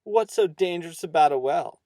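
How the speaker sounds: loud, cheeky challenge to an elder; rude. A narrator's reading. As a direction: speak up boldly, rudely to the farmer. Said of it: "Stanley got all rude and spoke up".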